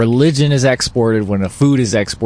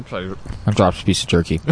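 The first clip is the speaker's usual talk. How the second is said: chewing while talking; 4 semitones lower, 5 dB quieter than usual. Real, not acted. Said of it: "sorry for the chewing and eating sounds".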